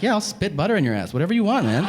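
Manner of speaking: a hammed-up wife, amused and willing; mock wife. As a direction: play the wife as cheerfully game. Wife voiced in a bit.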